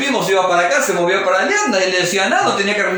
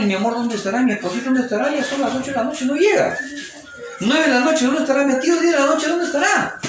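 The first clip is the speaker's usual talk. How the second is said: higher, softer fretting wife voice; worried wife; 5 semitones higher, 3 dB quieter than usual. Acted. Wife alone at night wondering where her husband is, higher and anxious. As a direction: pace and fret as the hours pass.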